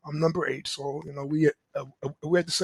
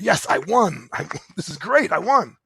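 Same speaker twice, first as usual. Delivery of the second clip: triumphant cheer; 8 dB louder than usual. Retold or imitated. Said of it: "I thought it was a victory".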